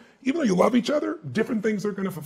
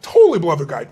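emphatic; 9 dB louder, faster than usual. Real, not acted. Emphatic agreement.

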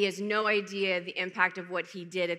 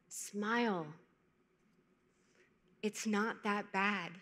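soft, slow, gentle reassurance; 5 dB quieter, much slower than usual. Retold or imitated. Stranger gently tells a passing model to smile, soft and gentle. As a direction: softly voice a stranger's kind word, unhurried. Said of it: "he grabs my arm gently".

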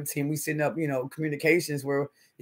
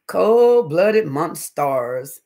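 slow, louder, stretched-out call of the name; drawn-out greeting; 6 dB louder, much slower than usual. Real, not acted. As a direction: stretch the name out like a big welcome. Greeting a chat member.

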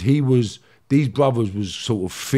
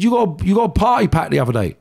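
higher, louder accusation; re-enacted row, short of screaming; retold irate confrontation; 8 semitones higher, 6 dB louder than usual. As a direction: press the accusation with raised voice. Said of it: "screaming at him when I'm talking".

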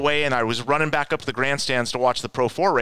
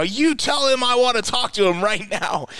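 much higher, drawn-up insistent demand; retold angrier demand; 10 semitones higher than usual. Caller insisting after the secretary refuses, pissed and more forceful. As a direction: repeat the order, pushing harder. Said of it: "I'm pissed".

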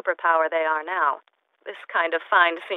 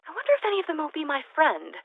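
hopeful wondering; 8 semitones higher, faster than usual. Acted. Lonely wistful aside.

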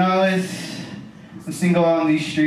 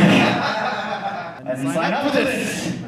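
ordinary delivery

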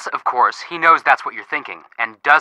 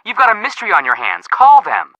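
urgent push; 7 semitones higher than usual. Acted. Urging Nancy to call.

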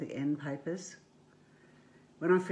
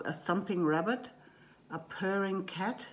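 usual speaking voice

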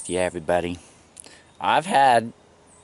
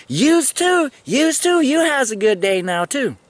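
high, loud, sing-song parroting; mock echo of her dialect; 4 dB louder than usual. Answering the sergeant by echoing her dialect, in a high mock sing-song. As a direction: throw her words back, hammed up. Mimicking her grammar back.

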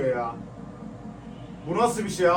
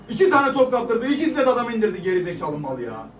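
loud, higher outburst; angry shout; 4 semitones higher, 4 dB louder than usual. Real, not acted. Fan shouts angrily about the defender's blunders. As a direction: blast the defender's mistakes.

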